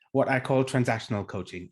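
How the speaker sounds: normal talking voice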